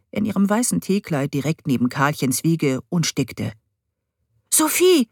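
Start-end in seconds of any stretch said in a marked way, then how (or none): 4.5-5.1 name said high, full of pride; proud. A narrator's reading. Boy presenting his find to his stepmother, proudly. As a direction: swell with pride as you say her name. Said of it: "Stolz quoll aus seiner Stimme".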